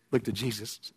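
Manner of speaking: quiet low echo of the call; hushed plea. Acted. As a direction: drop to a soft, low hush.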